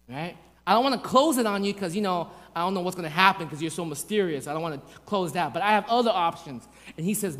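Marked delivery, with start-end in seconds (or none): none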